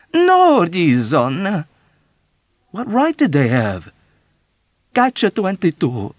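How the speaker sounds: very slow, high, loud wail. Acted. Old woman wailing to Yossarian, slow high lament. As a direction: stretch it into a mournful wail.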